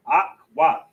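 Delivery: Ak, wath split into two clear syllables. Shown as a demonstration. Teacher breaking a Hebrew word into syllables for the class. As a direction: say Ak then wath with a gap between them. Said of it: "Two syllables, two connectors".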